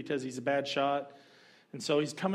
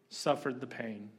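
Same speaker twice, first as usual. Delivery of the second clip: usual speaking voice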